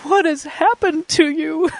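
high, slow, teary question; tearful disbelief. Retold or imitated. Girlfriend weeping asks the speaker what happened to him, high and slow. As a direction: ask it through tears, stunned. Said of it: "And she's like".

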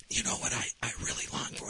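hushed, hesitant whisper. Retold or imitated. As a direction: whisper it as a confidence. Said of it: "I started whispering".

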